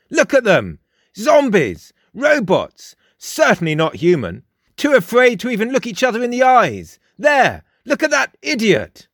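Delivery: high, emphatic mocking character; scornful Frost. A narrator's reading. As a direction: jeer at the crowd, pitched well up. Frost mocks the passing public.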